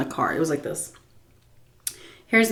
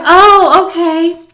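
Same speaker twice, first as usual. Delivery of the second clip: loud mock acceptance; 10 semitones higher, 9 dB louder, slower than usual. Meek retold reply.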